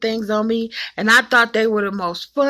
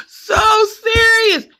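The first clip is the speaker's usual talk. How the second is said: laughing; 13 semitones higher, 6 dB louder, much slower than usual. Real, not acted. Laughing while retelling mom.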